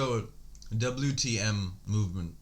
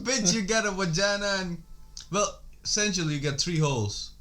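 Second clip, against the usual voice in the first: high, loud comeback; loud, high-pitched joking retort; mock exasperated retort; 8 semitones higher, 4 dB louder than usual. Mock retort to imagined objector.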